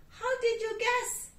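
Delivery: bright little rabbit pleased. Acted. Rabbit delighted the cat guessed carrots.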